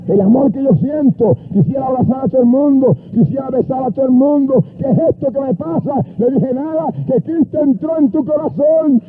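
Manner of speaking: high revival cadence rising to a stretched praise cry; impassioned preaching. Retold or imitated. Preacher retells a convert overwhelmed by love, fervent. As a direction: drive the retold exchange upward into praise.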